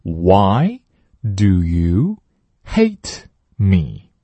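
very slow, stress on you; 慢速领读; slow model read. Shown as a demonstration. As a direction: spell it out slowly. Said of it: "第二句".

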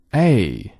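A glided from open a to e; a clear long gliding A; diphthong model. Shown as a demonstration. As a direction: slide the mouth from a to e on A. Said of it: "来跟着[name]一起朗读。".

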